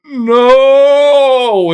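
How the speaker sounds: high, loud yelled no; horrified shout. Retold or imitated. Cousins reacting to dad's joke, shocked shout. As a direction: scream it in shock.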